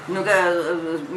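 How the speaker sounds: usual speaking voice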